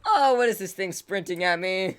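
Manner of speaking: laughing retelling. Retold or imitated. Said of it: "I was just like".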